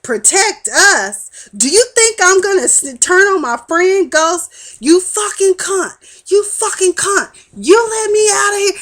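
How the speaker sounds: mock Tommy screaming furiously; furious shout. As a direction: explode at her in rage. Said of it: "Tommy snaps loose".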